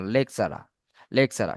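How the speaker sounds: "লেকচারার" ending in an open "-aa", no final r, low and falling. Shown as a demonstration. Teacher models British English for students, "লেকচারার" without its final r. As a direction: drop the last r of "লেকচারার": leksara. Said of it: "British English আমরা উচ্চারণ করবো না".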